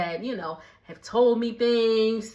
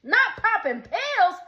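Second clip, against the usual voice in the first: teasing correction; 8 semitones higher, 5 dB louder than usual. Retold or imitated. Reacting to the reunion fight, teasing in a high sing-song. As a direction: stretch it out mockingly up high. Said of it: "And I said".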